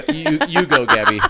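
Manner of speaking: high, loud teasing push; loud playful urging. Real, not acted. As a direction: cheer her on to talk. Urging friend to talk, loud.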